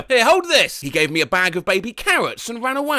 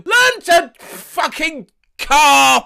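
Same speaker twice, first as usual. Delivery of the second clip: slow, loud, high yell out the window; yelling driver; 8 semitones higher, 7 dB louder, much slower than usual. Retold or imitated. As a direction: bellow it at the pedestrian. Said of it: "the driver yelled at me".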